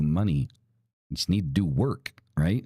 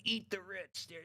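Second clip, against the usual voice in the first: much higher, much softer and slowed whiny mimicry; mocking quote; 8 semitones higher, 10 dB quieter, slower than usual. Mocking the eat-the-rich crowd with a soft, high whine. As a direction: mimic them as limp, whining complainers. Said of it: "people that are like".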